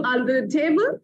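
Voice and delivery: usual speaking voice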